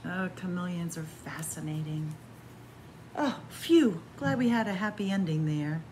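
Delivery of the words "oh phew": plain reading voice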